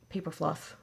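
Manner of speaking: ordinary talk, nothing marked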